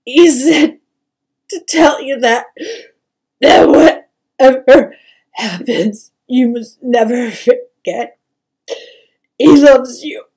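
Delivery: loud, broken sobbing delivery; anguished mother, voice cracking into weeping; overwhelmed with sadness. A narrator's reading. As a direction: push each phrase out between sobs. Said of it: "overwhelmed with sadness".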